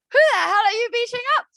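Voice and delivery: high, loud alarmed question; shocked mum. Retold or imitated. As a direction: ask in mock alarm. Mother startled at son.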